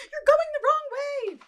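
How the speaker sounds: very high sing-song coaxing; baby talk. Acted. Owner baby-talking to her cat. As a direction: coo at the pet.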